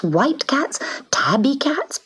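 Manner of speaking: ordinary delivery, nothing marked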